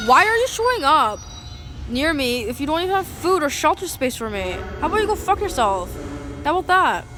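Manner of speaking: enraged comeback to the police; furious retort. Retold or imitated. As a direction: blast it at the cops harassing you. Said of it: "And I'm like".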